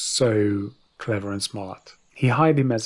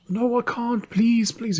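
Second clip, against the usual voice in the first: put-on high whiny pleading drunk voice; pleading drunk ruler; 13 semitones higher, slower than usual. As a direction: beg for your life, drunk and terrified.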